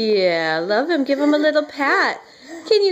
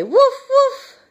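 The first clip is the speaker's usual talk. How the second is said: high, laughing woof woof; laughing dog bark imitation; 10 semitones higher, 5 dB louder than usual. Shown as a demonstration. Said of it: "What's the dog say?".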